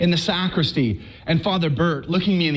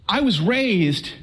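ordinary delivery

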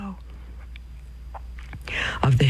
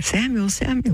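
calling voice; 9 semitones higher than usual. Retold or imitated. A voice calls the sleeping boy Samuel, high calling voice. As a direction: call the sleeper by name, pitched well up.

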